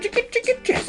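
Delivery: tiny squeaky chicken call; high chicken squeak. Acted. Host squeaks the show's chicken call.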